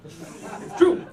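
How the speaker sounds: ordinary delivery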